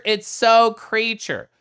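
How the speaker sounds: high, loud and slow; slow delighted squeal; drawn-out gush. Real, not acted. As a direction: stretch it out adoringly.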